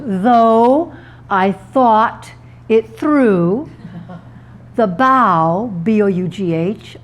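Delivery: slow, spaced-out dictation with long pauses; deliberate word-by-word dictation; dictating. Shown as a demonstration. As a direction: dictate it bit by bit, drawing out key words. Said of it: "if you dictate this sentence".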